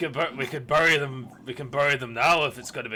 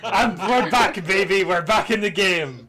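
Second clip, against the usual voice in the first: excited; 6 semitones higher, 7 dB louder, slower than usual. Real, not acted. Player celebrates return to game.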